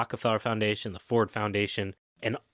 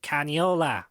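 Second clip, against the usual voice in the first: put-on heavy Jersey accent, pitched up; thick Jersey accent; 6 semitones higher, 3 dB louder, slower than usual. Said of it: "with that thick Jersey accent".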